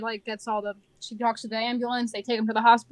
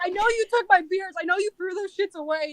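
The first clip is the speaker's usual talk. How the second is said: higher-pitched mock accusation; imitated screaming; 6 semitones higher than usual. Drunk man accusing the manager, imitated yelling. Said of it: "like, screaming".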